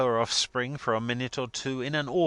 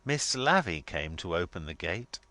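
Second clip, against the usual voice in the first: usual speaking voice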